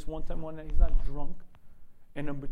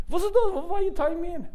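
much higher, louder panicked cry; a yeshiva man strapped into a car, panicking; panicked Shmuel; 13 semitones higher, 5 dB louder, faster than usual. Retold or imitated. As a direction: yelp as the strap goes on.

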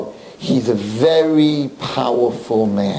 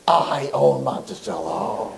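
low, slow and softer threat; menacing owner; 4 semitones lower, 5 dB quieter, slower than usual. Retold or imitated. Owner warning the rabbi who he's up against, low and slow. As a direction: murmur it like a quiet mob boss. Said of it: "And he's like".

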